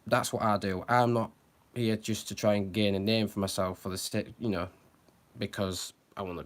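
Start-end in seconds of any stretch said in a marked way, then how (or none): none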